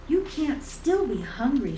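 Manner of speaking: high, loud, disbelieving; mock-scold a greedy cat; surprised. A narrator's reading. Teasing her greedy cat.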